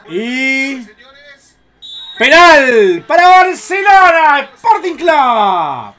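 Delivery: drawn-out loud slow announcement; penalty call. Real, not acted. Fan commentator announcing the penalty, slow loud call. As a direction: stretch every word of the call at full voice.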